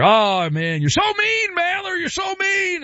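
very high mock protest; whining critic. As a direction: wail it like an offended fan. Acting out an upset listener's protest.

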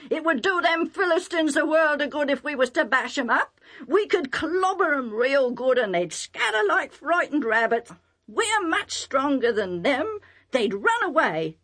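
put-on cocky young master. Young master urging herdsmen to bash Philistines, cocky. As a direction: goad them with boastful relish.

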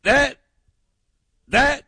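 hammed-up excited caller; mock fired-up stammer. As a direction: play him too pumped to find the word. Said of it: "he was like".

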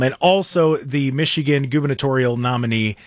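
ordinary delivery

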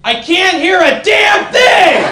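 put-on high, slow cracked shout of an old lady; deaf old woman. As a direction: yell like a deaf granny in the back row. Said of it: "one old woman in the back go".

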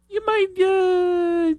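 sudden squeaky falsetto; comic high-pitched squeak. Acted. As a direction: jump way up into a squeak.

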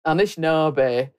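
slow, low Northern English accent; deep, unhurried Northern English voice. Shown as a demonstration. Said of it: "do a very good Northern English accent".

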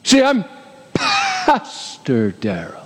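title drawn out very slowly; pompous mock self-introduction; mock self-important. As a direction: stretch "Pastor" with mock grandeur. Mocking pride in one's own title.

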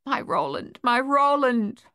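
overcome, stretch out the repeated name; weeping. A narrator's reading. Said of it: "Francois wept".

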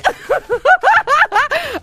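high cackling laughter; loud high laugh. Real, not acted. Host laughing at her own sour-temper joke, loud high laugh. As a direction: let a big high laugh burst out.

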